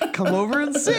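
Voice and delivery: high laughing invitation. Real, not acted. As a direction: giggle it out. Laughing at scratched furniture.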